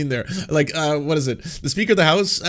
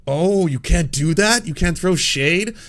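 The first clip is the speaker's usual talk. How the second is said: mock surprise; 5 semitones higher, 3 dB louder, slower than usual. Real, not acted.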